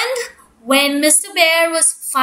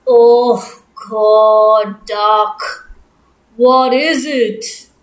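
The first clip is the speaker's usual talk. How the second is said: grumpy bear voice; 7 semitones lower, 4 dB louder, much slower than usual. Acted. Woken bear, low annoyed.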